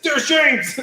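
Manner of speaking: high, loud excited scream. Retold or imitated. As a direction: yell it out at the sight of a friend. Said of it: "Muerte screaming".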